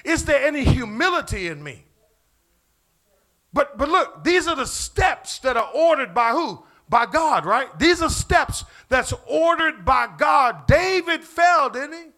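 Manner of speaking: loud, high preaching build; fiery preaching. Acted. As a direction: bring it home to the congregation. Preaching at full force.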